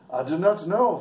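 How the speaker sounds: high, loud mock Irish accent. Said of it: "Sorry about my English or Irish accent".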